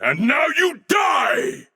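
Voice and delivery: huge high shout, full force; battle cry. Acted. Warrior finishing off a foe, huge high battle shout. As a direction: scream the killing blow at the enemy.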